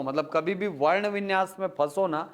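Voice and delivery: ordinary talk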